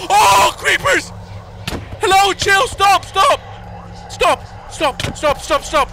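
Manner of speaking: high frightened pleading shouts; panicked yelling. Real, not acted. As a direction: beg them to stop, scared. Monster attack, begging to stop.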